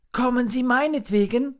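very high, small creature voice; little monkey, anxious question. A narrator's reading.

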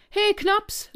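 high, loud call to a playmate. A narrator's reading. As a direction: call out eagerly, pitched well up. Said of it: "rief Fips".

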